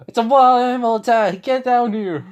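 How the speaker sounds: very high, loud call of alarm; warning shout. Acted. Looking back and shouting at the others to get down, loud warning. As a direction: bellow it to the people below.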